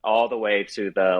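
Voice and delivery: ordinary delivery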